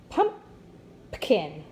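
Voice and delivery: "Pumpkin." split as PUM, pause, puh-kin; wrongly divided syllables. Shown as a demonstration. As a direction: break "Pumpkin." after PUM, stressing it, then p-kin. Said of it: "put our accent on the first syllable".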